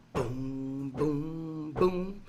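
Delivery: low mouth-drum booms; beatbox the bass under the song; vocal bass beat. Pretend. Beat between sung lines.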